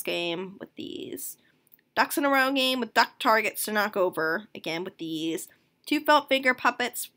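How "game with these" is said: soft whispered aside; whispering. Real, not acted. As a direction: whisper it to yourself. Whispered aside while reading.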